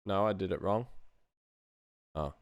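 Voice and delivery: ordinary talk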